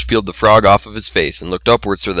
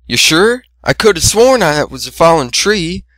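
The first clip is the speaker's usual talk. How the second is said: higher teasing sister voice; 8 semitones higher than usual. A narrator's reading. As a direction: tease your brother, pitched up.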